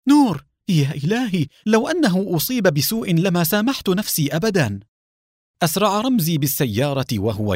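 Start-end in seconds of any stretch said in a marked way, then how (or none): 0.1-4.8 terrified cry. A narrator's reading. Salwa hearing the gunshot, crying out in terror. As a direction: gasp it out in fear for Nour. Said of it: "وصاحت برعب".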